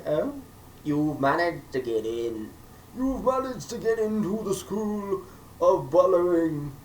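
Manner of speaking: drawn-out stuffy butler phone call; pompous butler. Retold or imitated. Butler phoning Emma with her acceptance, pompous and slow.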